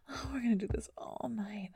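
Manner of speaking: ordinary talk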